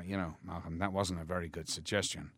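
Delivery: quiet, dry reproach; Kubrick impression. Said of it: "he calls me over and he goes".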